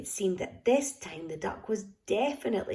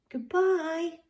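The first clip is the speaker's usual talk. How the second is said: high, called-out goodbye; 9 semitones higher, 4 dB louder than usual. A narrator's reading. The little girl calling goodbye to Gumboot, a high call. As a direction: call goodbye across the path. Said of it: "called the little girl".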